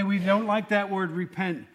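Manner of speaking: usual speaking voice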